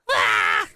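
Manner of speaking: startled shout. Real, not acted. Startled cry.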